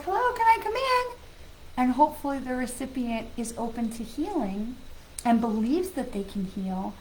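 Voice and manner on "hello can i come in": higher, polite little voice at a door; love and light asking politely to enter; sweet knocking visitor. Pretend. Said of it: "knock at the door and be like".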